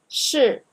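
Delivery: 是 said loud and high as a model; 是, shi, punched out on its own; 是 as a loud model word; model word, stressed. Shown as a demonstration. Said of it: "is equal to am".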